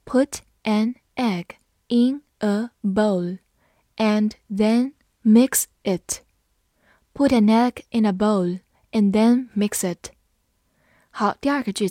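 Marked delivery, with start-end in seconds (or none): 0.1-6.2 slow, deliberate read-aloud; slow classroom model reading. Shown as a demonstration. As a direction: pause between phrases, stretch each word. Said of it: "好，慢读一遍。".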